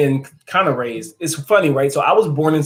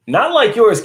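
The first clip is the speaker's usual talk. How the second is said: higher and louder teasing jab; playful higher call-out; teasing exclamation; 9 semitones higher, 7 dB louder, slower than usual. Real, not acted. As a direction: poke fun at his accent. Teasing a viewer's accent.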